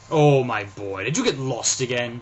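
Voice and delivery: father teasingly scolding his son; mock anger. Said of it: "he said in mock anger".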